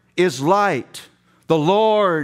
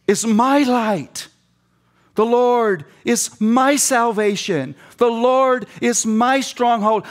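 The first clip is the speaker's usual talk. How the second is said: "my" stressed, higher and slow; slow, raised, lean on "my" every time; 5 semitones higher, slower than usual. Shown as a demonstration. Preacher repeats the psalm to his flock, stressing my. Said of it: "There better be a big my".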